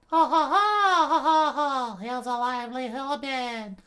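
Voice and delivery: giant laugh. Acted. Giant laughs at tiny human.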